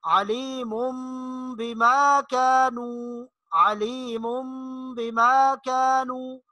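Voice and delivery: अलीमुम बिमा कानू slow, nasal म before ब; slow recitation. Shown as a demonstration. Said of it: "तनवीन की मिसाल देखिए". (अलीमुम बिमा कानू धीरे गुन्ना के साथ)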